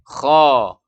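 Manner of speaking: loud KHO, heavy and long; thick kho. Shown as a demonstration. Teacher repeats the right reading, thick kho. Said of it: "cara membacanya".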